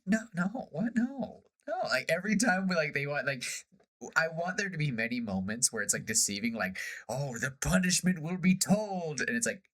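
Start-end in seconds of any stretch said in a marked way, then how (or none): 0.1-1.7 high, startled no-nos; high denial. Retold or imitated. Crew laughing off the fearsome dragon question, high and startled. 7.1-9.2 mock ominous. Ominous pirate threat.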